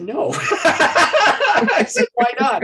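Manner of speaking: high, loud, laughing. Real, not acted. Retelling wife and dad's refusal, laughing. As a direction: laugh through it.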